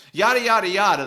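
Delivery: high, loud brush-off of the rest; dismissive yada yada. Retold or imitated. Dad's rant at the dealer cut short with a loud dismissive yada.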